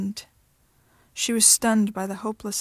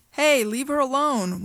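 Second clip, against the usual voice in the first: yelling; 4 semitones higher, 4 dB louder than usual. Acted. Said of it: "one of the men yelled".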